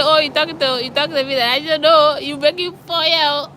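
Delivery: high teasing Liberian English; repeating the joke to the cook in Liberian English; Liberian slang. Retold or imitated.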